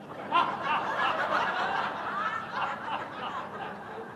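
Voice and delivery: long high laugh. Real, not acted. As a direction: laugh it off, amused. Laughs at the quick exit plan.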